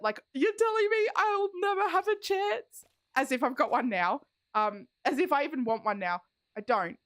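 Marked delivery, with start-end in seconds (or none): 0.3-2.8 high, hammed-up despair; mock heartbroken. Imagining the actor taken, hammed-up heartbroken whine. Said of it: "I'd be so upset".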